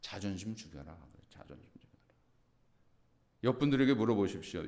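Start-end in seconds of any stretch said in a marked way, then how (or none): 0.0-1.0 soft command. Shown as a demonstration. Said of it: "그 뭐냐면". 1.3-2.2 whispered. Shown as a demonstration. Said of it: "그 뭐냐면".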